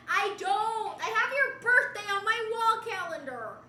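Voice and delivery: high, loud offended denial; indignant protest. Real, not acted. Streamer proving friendship to chat, indignant protest. Protesting chat's accusation of hate.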